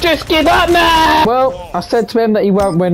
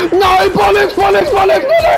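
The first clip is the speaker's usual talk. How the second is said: very high, loud repeated yelled bollocks; frustrated yelling; 5 dB louder than usual. Real, not acted. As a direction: yell it out in frustration.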